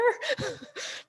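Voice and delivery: high, breathy giggle; giggling at herself. Real, not acted. Laughing at her own French.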